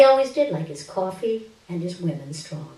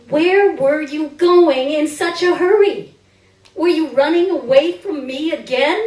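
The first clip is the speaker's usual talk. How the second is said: accusing question; 5 semitones higher, 7 dB louder than usual. Acted. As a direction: demand it of the father who ran off. Confronting absent father, accusing.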